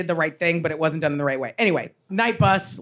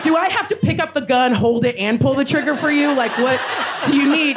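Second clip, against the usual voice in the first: exasperated shout; 6 semitones higher than usual. Retold or imitated. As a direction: throw it back at him, fed up. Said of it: "I was like".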